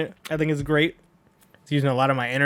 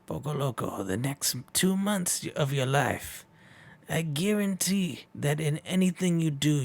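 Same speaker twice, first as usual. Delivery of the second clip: soft, slow prophetic voice; mysterious fortune voice; 4 dB quieter than usual. Acted. Oracle voice foretelling Poco Loco's lucky streak, soft and slow.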